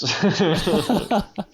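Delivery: high-pitched giggle. Real, not acted. Laughing at own sober act.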